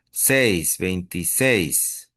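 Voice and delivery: "veintiséis" drawn out slowly, stress on final "séis"; stress on SEIS. Shown as a demonstration. Teacher showing students the accented syllable, slow final stress on "veintiséis". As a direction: isolate "Seis", then land hard on the end of "veintiséis". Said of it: "Solo esa tiene acento en veintiséis".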